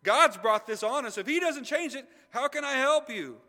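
loud, high desperate outcry; acted royal despair at full voice; desperate king. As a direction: cry out in helpless desperation. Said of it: "desperation in the king's voice".

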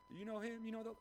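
high-pitched voice of a nosy bystander; prying questioner. Acted. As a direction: pester him with questions, pitched well up. Acting those who question Peter.

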